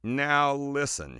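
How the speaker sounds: slow, drawn-out warning opener; hesitant. Retold or imitated. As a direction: ease into bad news carefully. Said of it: "she said hesitantly".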